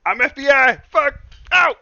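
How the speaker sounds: high, loud comic outburst; hammed-up pain yell. Joking rapper [name] hurt on glass, hammed-up yelling. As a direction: shout through pretend pain.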